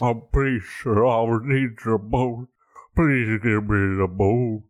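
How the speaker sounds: hammed-up pitiful old-man begging; grovelling. Old man begging the boatman for the boat, grovelling. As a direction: plead in a slurred, feeble put-on voice. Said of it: "You don't gotta grovel like that".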